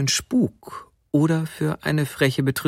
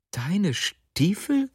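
slow, high, bewildered question; stunned disbelief; 8 semitones higher, 4 dB quieter, much slower than usual. A narrator's reading. Wife repeating her husband's claim, utterly baffled. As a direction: repeat it slowly, at a loss. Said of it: "wiederholte die Frau fassungslos".

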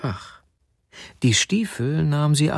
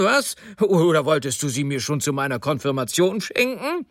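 high-pitched sarcastic tirade with bitter laughs; outraged rant; 6 semitones higher than usual. A narrator's reading. Husband raging at his wife over his bought-back boots, incredulous. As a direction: rail in exasperated disbelief.

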